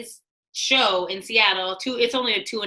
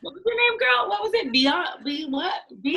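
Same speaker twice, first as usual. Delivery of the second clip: high, mocking pretend forgetfulness; mock-clueless teasing; 9 semitones higher than usual. Joking to the interviewer about [name], high mock-clueless teasing. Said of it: "I'm just kidding".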